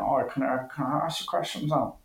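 quiet, high voice from off to the side; put-on guest voice, hushed and distant; Eddie O'Sullivan. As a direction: pipe up softly as the waiting guest. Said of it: "Eddie O'Sullivan is going to".

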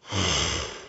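a sigh through a yawn; stifled yawn. Real, not acted. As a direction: breathe out a tired yawn. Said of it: "fighting that yawn".

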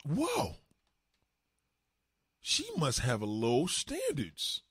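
drawn-out mock amazement, swooping high; astonished realization. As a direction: stretch each word out, marveling in hammed-up disbelief. Acting the bum's astonished realization.

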